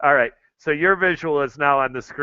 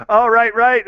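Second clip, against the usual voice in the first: laughing; 7 semitones higher, 6 dB louder than usual. Real, not acted. Reacting to a friend's joke.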